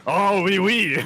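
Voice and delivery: an Italian trying to sound French; fake French voice. Mocking a TV personality to a friend, in a hammed-up fake French voice. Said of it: "crazy, very Italian, but trying to be French voice".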